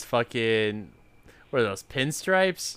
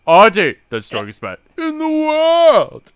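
much higher, much louder booming intro; proud strongman bellow; 11 semitones higher, 11 dB louder than usual. Retold or imitated. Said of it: "You did the voice and everything".

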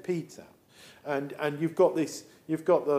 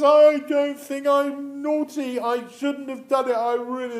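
tearful confession; 7 semitones higher, 6 dB louder than usual. Acted. Returning son confessing to his father, tearful. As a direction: wail it like a naughty child owning up. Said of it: "tears are going down".

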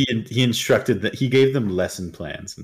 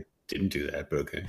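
mumbled aside; 6 semitones lower, 11 dB quieter, faster than usual. Retold or imitated. Retold quote, much softer.

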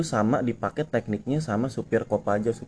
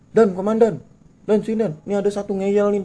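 high, lightly voiced officer calling out; calling the commander; 9 semitones higher than usual. Officer calls his commander over to report the rider, high call. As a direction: call the boss over to report a stubborn one.

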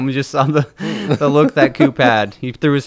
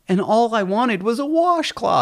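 mock quote; 10 semitones higher, slower than usual. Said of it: "then she's like".

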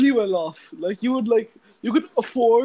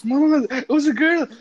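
ordinary talk

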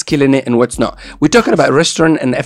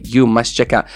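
normal talking voice

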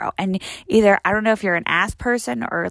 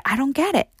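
ordinary delivery

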